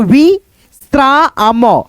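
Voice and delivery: slow, careful विश्राम; slow demo. Shown as a demonstration. Teacher modelling the word slowly for pupils. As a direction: stretch विश्राम out clearly. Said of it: "ऐसे करके".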